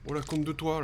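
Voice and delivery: high, loud frustrated cry; frustrated outburst. Real, not acted. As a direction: burst out in frustration. Frustrated at failing task.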